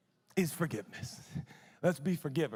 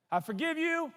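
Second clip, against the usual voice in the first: higher declaration; declared aloud; 10 semitones higher than usual. Shown as a demonstration. Preacher showing how to forgive aloud, higher declaration. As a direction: say it out loud, high. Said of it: "I say it out loud".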